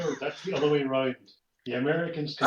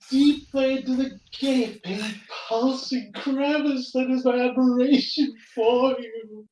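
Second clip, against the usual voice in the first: breathless, high, swooning gush; swooning admiration; 7 semitones higher, 4 dB louder than usual. Acted. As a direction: gush over him, giddy and high. Swooning admiration, higher and louder.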